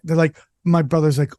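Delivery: usual speaking voice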